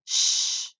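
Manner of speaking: a sustained "Sh" with no vowel; isolated sh sound. Shown as a demonstration. Teacher has children echo her, isolated sh. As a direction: model "Sh" as one steady hushing noise. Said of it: "Be my echo".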